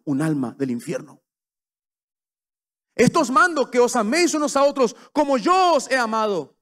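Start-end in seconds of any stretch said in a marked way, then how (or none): 2.9-6.6 higher and louder proclamation; preaching. Acted. Preacher proclaiming Christ's command, loud and high. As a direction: proclaim the verse to the hall.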